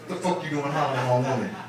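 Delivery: ordinary talk, nothing marked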